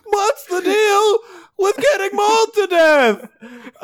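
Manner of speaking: long high wail into a drawn-out complaint; hammed-up agonized yelling, stretched out; screaming while mauled. Comedian character mauled by a bear, screaming in character.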